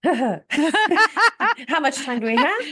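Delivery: laughing hard into a slow, high, loud question; amused deflection, cracking up; real laughing. Real, not acted. Joking reply amid laughter.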